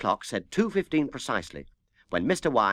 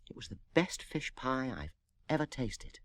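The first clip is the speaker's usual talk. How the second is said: much softer, hushed aside; whispered; 2 semitones higher, 10 dB quieter than usual. Acted. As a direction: lean in and whisper the compliment.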